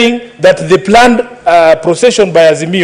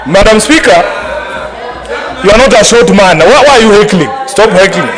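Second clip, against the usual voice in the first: raised voice over heckling; shouting order order. Real, not acted. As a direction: shout it over the chamber noise. Heated exchange with heckling senators.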